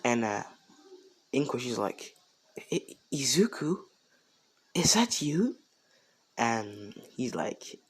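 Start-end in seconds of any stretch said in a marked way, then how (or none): 2.7-5.6 lightly acted, hesitant disbelief; stunned recognition. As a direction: stammer the name, rising into a higher question. Mother recognises long-lost son.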